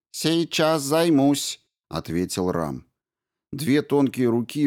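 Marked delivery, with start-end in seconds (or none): 0.1-1.6 higher, very slow machine reply; slow robot voice. A narrator's reading. Ram the robot agrees to start surgery, slow and mechanical. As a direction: answer like a calm robot.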